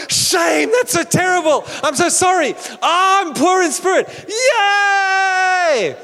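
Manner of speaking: high, loud, gleeful shouting; mock joyful shout. Preacher acting out a gleeful boast, shouting. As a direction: celebrate loudly as if it's good news. Acted joyful reply to someone.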